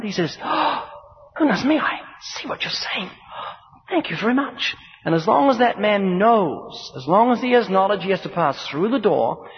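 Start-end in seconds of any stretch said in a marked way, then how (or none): 0.4-4.8 high, breathy gasp of dawning alarm; shocked realization. Acted. Passenger grasping the danger of the jump, breathy shock. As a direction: act out a hushed, high-pitched shock.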